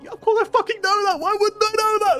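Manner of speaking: very high, loud, shrieking outrage; furious inner yell. Retold or imitated. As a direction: scream it at the ceiling in disbelief. Exasperated inner reply to dad.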